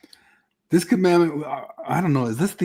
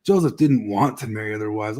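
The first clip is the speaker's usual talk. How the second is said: ordinary delivery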